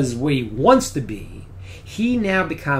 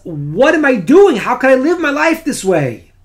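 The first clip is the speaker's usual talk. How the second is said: anguished outcry; 9 semitones higher, 8 dB louder than usual. Retold or imitated. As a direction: cry out as it dawns on you. Executioner's sudden moral shock.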